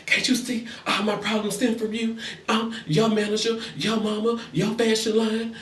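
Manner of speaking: a hint of whiny nagging; mock nagging girlfriend. Girlfriend voiced nagging in recap.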